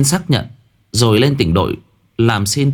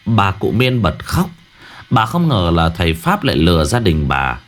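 plain reading voice

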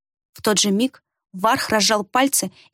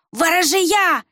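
hoarse gasp; 8 semitones higher, much slower than usual. A narrator's reading. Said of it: "сипло выдохнул Варх".

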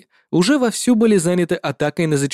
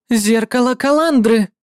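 spell shout; 7 semitones higher, 4 dB louder than usual. Acted. Defender shouts counter-spell.

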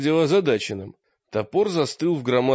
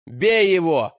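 loud rallying shout; 5 semitones higher, 6 dB louder, slower than usual. A narrator's reading. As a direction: shout it to the crowd. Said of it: "закричал Олег громко".

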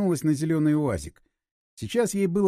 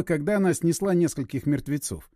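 ordinary delivery, nothing marked

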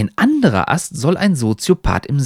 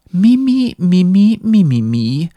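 high, loud mock whimper; jeering, high-pitched mimicry; mocking whine; 6 semitones higher, 4 dB louder than usual. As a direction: mimic a whiner in squeaky little syllables. Hat mocks Ernst's whining.

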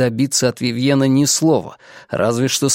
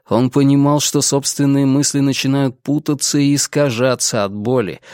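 usual speaking voice

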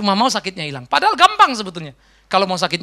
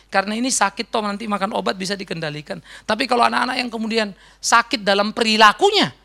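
ordinary talk, nothing marked

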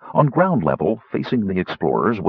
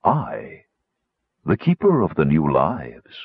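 low, measured bass voice; deep voice of a mysterious being; soothing bass; 5 semitones lower than usual. A narrator's reading. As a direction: announce yourself in a deep, soothing voice. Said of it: "said a soothing bass voice".

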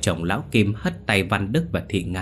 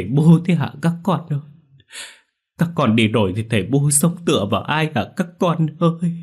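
loud, high wailing grief; wailing lament; 5 semitones higher, 4 dB louder than usual. A narrator's reading. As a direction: wail for the lost children. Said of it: "miệng không ngừng mếu máo khóc lóc". (than khóc gào lên, giọng cao và to)

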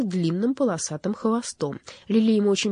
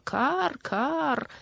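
high, drawn-out crow call; crow's caw; 9 semitones higher than usual. A narrator's reading. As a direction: caw like a would-be opera-singing crow. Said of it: "она заголосила во всё горло".

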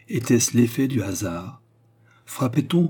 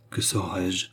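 plain reading voice

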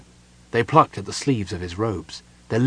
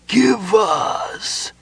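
high, drawn-out hissing plea of the dead; pleading ghostly whispers; 12 semitones higher than usual. A narrator's reading. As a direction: plead slowly like a hungry ghost. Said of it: "pleaded in their terrible whisperings".

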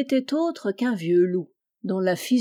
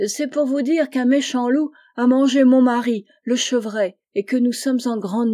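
tearful; 4 semitones higher, 6 dB louder than usual. Acted. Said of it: "en versant de grosses larmes".

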